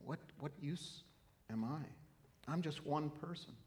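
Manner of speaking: hushed doubtful churchgoer; timid self-doubt. Retold or imitated. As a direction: mutter it like nobody special. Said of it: "You might think".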